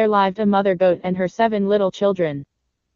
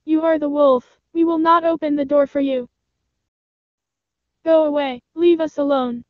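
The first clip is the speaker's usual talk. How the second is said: put-on much higher kid-goat voices; defiant little goats; 7 semitones higher than usual. Little goats refuse the wolf at their door, high defiant voice. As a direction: pitch it high and send the wolf off.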